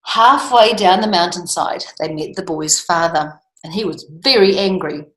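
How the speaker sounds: ordinary delivery, nothing marked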